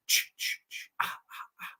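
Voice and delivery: whispered rhythmic "ch ch ch, ah ah ah"; voiceless, hushed slasher-theme echo; imitated horror motif. Imitates Friday the 13th motif.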